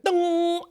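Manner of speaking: high ringing dong sound like a head hitting a pole; imitated metal dong. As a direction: ring out dong like a struck pole. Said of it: "all I heard".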